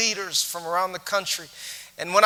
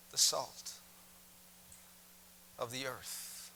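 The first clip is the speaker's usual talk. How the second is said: quiet, earnest preaching; soft sermon close; 5 dB quieter than usual. Acted. Preacher gently urging the farmers to be salt.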